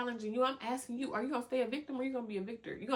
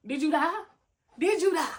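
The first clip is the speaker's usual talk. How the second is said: higher, louder rhetorical jab; loud teasing challenge; 6 semitones higher, 8 dB louder than usual. Real, not acted. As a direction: tease them out of self-pity.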